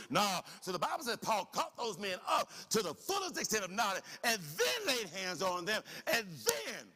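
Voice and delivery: fired-up pulpit preaching; full-force preaching. Acted. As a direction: preach it out to the congregation.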